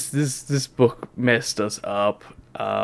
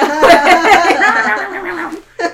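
loud laugh; 10 dB louder than usual. Real, not acted. Laughing at the cat bit.

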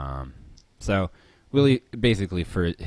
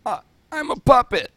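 very high, loud puppet voice; Alf impression; 8 dB louder than usual. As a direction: shriek it like a puppet. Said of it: "do Alf again".

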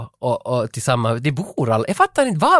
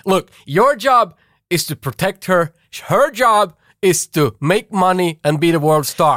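manager's lecture; 3 semitones higher than usual. Retold or imitated. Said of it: "han säger ju också åt Frank Farmer".

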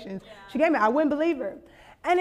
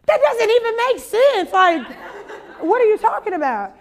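incredulous; 6 semitones higher, 4 dB louder than usual. Retold or imitated. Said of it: "I'm like".

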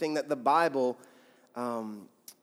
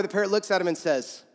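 normal talking voice

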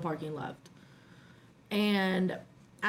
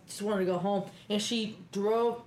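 usual speaking voice

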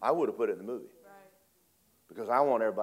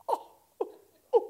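high laugh; 3 dB louder than usual. Real, not acted. Preacher laughs.

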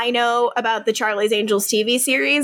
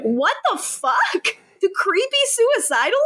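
mock-appalled, voice shooting up high; shocked disbelief; 11 semitones higher than usual. Acting out Damon's shocked reaction.

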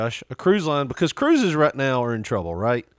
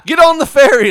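high, loud, laughing exclamation; loud excited yell; 12 semitones higher, 12 dB louder than usual. Pretend. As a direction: belt it out, amazed. Mocking the stormy ferry ride.

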